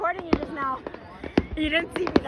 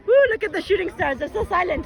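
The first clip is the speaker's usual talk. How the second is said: usual speaking voice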